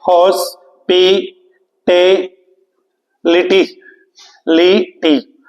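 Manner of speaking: Hospitality drawn out slowly, syllable by syllable; split into syllables. Shown as a demonstration. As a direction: break hospitality into its syllables, each one slow and separate. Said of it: "देखिए कितने syllable हैं इसमें?".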